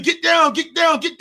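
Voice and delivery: loud high shouted warning; desperate shout to the quarterback to get down; screaming get down. Retold or imitated. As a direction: yell it at the TV like a frantic fan. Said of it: "I'm screaming".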